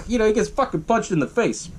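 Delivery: ordinary talk, nothing marked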